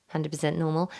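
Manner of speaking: usual speaking voice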